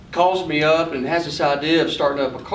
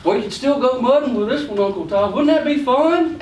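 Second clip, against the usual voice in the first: put-on coaxing little boy; 7 semitones higher than usual. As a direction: sweet-talk your uncle into a toy. Boy pitching the cheaper truck.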